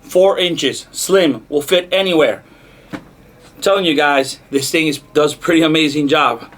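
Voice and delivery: projecting hard over the music; loud over music. Real, not acted. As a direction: push the whole outro out loud to beat the speaker. Wrap-up while music plays loud.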